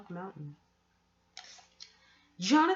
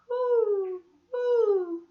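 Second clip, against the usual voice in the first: high, loud owl hoot; long high owl call; 11 semitones higher, 7 dB louder than usual. A narrator's reading. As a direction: hoot like a lonely owl in the dark woods. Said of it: "That was an owl".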